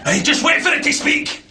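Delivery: ordinary delivery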